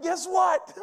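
high, excited news call; bursting to share the news; excited shout. Retold or imitated. Preacher calling everybody with big news, excited.

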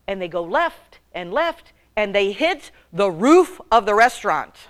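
ordinary talk

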